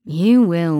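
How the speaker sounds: menacing killer. A narrator's reading.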